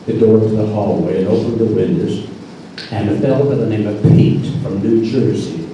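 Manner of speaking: usual speaking voice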